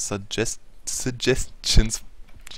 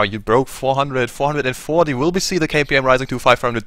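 much higher and louder, building hype; excited; 6 semitones higher, 7 dB louder than usual. Real, not acted. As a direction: yell it like a countdown win. Counting up to the goal.

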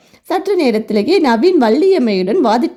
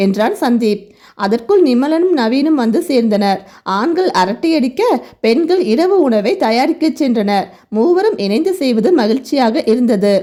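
plain reading voice

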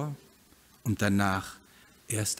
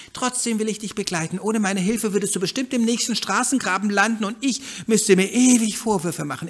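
higher duck voice, a bit fast and urging; cautious old duck; 11 semitones higher, 7 dB louder than usual. Acted. Duck urges hen to stay in the backyard, higher. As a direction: talk the friend into staying safe.

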